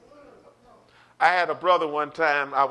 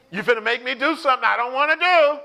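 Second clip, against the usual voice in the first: fast, raised-pitch warning; acted angry warning; 6 semitones higher, 4 dB louder, faster than usual. Angry person telling the other to calm down, acted heated warning. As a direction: build to a heated threat. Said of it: "when you're angry".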